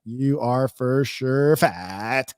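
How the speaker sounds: each word slowed right down; slow emphatic. Retold or imitated. Streamer hammering the insult at the guy, slow and deliberate. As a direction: drag out "You are for sure fat" to hammer it home. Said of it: "Then I was like".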